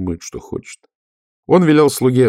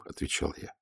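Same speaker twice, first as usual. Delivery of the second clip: ordinary delivery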